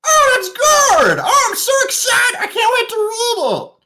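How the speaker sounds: put-on a thrilled young king showering praise; overjoyed boy king. Young king names his new royal advisor, high and thrilled.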